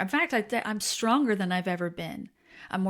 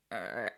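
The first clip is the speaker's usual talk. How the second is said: jaw-locked groan; 11 semitones lower, 6 dB quieter than usual. Retold or imitated. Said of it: "that I'd be like".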